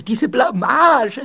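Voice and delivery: slow, loud wail swelling on Blamage; mortified wail. Acted. Ghost stuck in the wall fears discovery, loud mortified wail. Same lament repeated, building.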